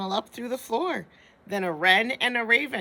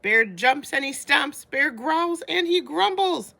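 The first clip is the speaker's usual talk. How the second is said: put-on bright, bouncy read-aloud for kids; lively story reading; 7 semitones higher, 5 dB louder than usual. A narrator's reading. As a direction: tell it big for little listeners. Reads picture book aloud.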